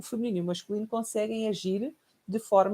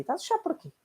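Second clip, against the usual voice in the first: impatient mother; 12 semitones higher, faster than usual. Retold or imitated. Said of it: "O que é que acontece".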